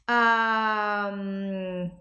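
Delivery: long, held "Ahn" closing into a hum; "Ahn" as a sustained schwa into M. Shown as a demonstration. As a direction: draw "Ahn" out as "ummm". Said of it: "fica com som de um".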